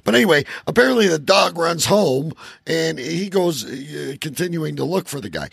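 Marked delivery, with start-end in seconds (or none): none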